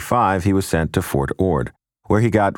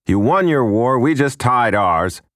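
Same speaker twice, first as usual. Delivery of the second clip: gruff retort; 7 semitones higher than usual. Retold or imitated. Uncle's retort to brother.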